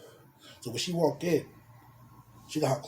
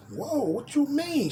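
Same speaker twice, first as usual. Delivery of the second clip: surprised; 10 semitones higher, slower than usual. Retold or imitated. Reacting to her claim.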